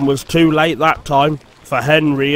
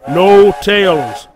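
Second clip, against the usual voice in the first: louder, slow firm order; 4 semitones higher, 8 dB louder than usual. A narrator's reading. Skarloey silencing the tattling coaches. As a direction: shut them down firmly. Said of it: "said Skarloey firmly".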